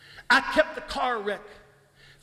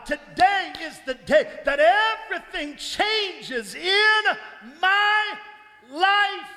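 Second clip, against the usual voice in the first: preaching at full force; 7 semitones higher, slower than usual. Real, not acted. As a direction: shout it to the rafters. Preaching climax.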